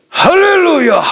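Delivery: high, slow, stretched cry of praise; a pastor crying out to the church in joy; ecstatic hallelujah. Real, not acted. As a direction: lift the whole room with it. Preacher calls out to congregation.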